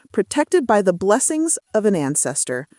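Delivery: plain reading voice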